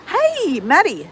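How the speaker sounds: high, bright-voiced hey; sudden high greeting; excited greeting. Real, not acted. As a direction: call out a cheery hello to a viewer. Greeting a viewer in chat.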